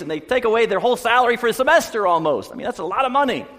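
indignant dad, pitched high and loud; fired up. Retold or imitated. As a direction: rant like an outraged, protective father. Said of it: "Dad, he's all fired up".

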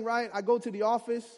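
usual speaking voice

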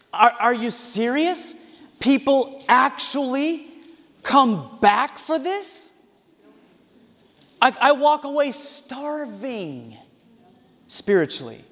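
slow, disbelieving; incredulous. Real, not acted. Pastor leaving a showy church, slow and incredulous. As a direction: drag out the disbelief. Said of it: "I just say".

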